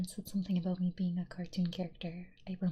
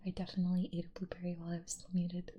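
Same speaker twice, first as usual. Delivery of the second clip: usual speaking voice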